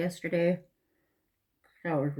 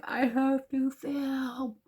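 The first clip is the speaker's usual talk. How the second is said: slow, high mock urgency; retold panic; 8 semitones higher, much slower than usual. Vlogger imitating her own earlier rush to film. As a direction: stretch it out, playing her earlier self. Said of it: "I was like".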